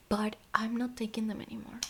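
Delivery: tired soft whisper. Real, not acted. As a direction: whisper it wearily.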